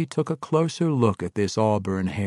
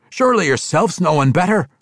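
loud, high, fast pleading dwarf; urgent dwarf; 10 semitones higher, 8 dB louder, faster than usual. Acted. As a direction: press Drizzt urgently. Emrys to Drizzt.

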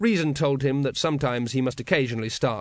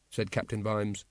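plain reading voice

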